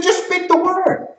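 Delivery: high-pitched, fervent appeal; pleading centurion. Acted. Centurion asking Jesus for the word, fervent.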